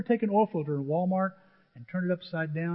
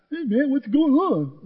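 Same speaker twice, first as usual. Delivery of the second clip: loud, high, drawn-out goofy greeting; hammed-up wisecracking kid; goofy joker; 9 semitones higher, 7 dB louder, slower than usual. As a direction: clown around in a silly put-on voice. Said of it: "I'm acting real goofy, cracking jokes".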